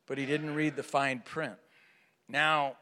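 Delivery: ordinary talk, nothing marked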